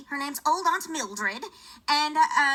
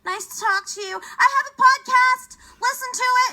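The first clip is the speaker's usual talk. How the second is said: eager plug; 8 semitones higher, 5 dB louder than usual. Retold or imitated. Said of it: "And I'm like".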